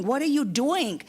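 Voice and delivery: frantic appeal to the wind god; exasperated outcry. Acted. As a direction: cry out in frustration. Indra's pleading climax.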